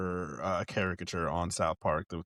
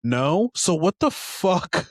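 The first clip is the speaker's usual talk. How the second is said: mock exasperated rant; 6 semitones higher, 5 dB louder than usual. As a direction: talk down to her, disbelieving. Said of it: "I've been like".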